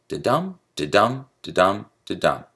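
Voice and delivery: "da-dum" four times, unstressed da and stressed dum; unstressed then stressed. Shown as a demonstration. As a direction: tap out "da-dum" in separate even pairs. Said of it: "alternating between unstressed and stressed syllables".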